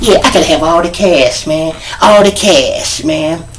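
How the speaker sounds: lightly put-on silly voice; funny inmate voice. Imitating the inmate repeating his robbery line, funny voice. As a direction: imitate him, stretching the last demand. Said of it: "had a real squeaky voice".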